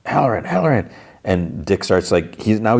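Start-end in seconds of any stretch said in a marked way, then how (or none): 0.1-0.9 restrained retold yell; mock yell. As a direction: imitate yelling after someone at storytelling volume. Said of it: "starts yelling his name".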